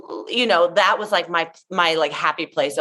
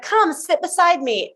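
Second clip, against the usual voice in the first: Debbie inviting; 9 semitones higher than usual. Retold or imitated. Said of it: "And then she was like".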